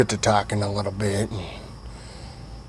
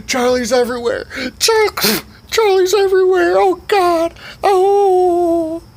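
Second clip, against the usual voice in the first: very high, loud mock sobbing screams; panicked screaming; 4 dB louder, slower than usual. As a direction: shriek in hammed-up panic, building louder. Overheard screaming upstairs.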